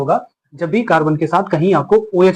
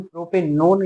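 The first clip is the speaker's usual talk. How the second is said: ordinary delivery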